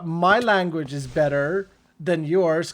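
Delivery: ordinary delivery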